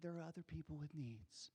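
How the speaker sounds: soft, whispered polite shushing; a quiet kindly shh; gentle hush. Acted. The crowd it was not, softly whispering shh. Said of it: "Not like".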